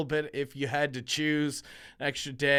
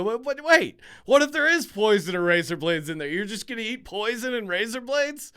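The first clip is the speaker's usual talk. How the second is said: put-on incredulous kid; 7 semitones higher, 6 dB louder than usual. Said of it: "I go".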